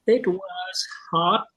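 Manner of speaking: ordinary talk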